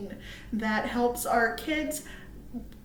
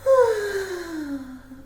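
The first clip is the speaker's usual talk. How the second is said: sleepy sigh; 5 semitones higher, 4 dB louder than usual. Acted. Bear falls asleep.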